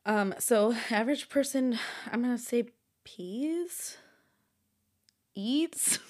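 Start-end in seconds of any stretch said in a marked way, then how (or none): none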